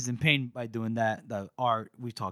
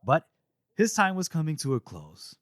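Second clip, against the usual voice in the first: ordinary talk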